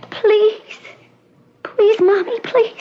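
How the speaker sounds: put-on high, childlike pleading; begging child. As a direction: beg her mommy like a small child. Patient begs mother.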